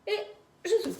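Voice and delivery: high, loud release on the breath; forceful exhale. Shown as a demonstration. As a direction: blow it all out. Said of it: "Je vous montre une fois".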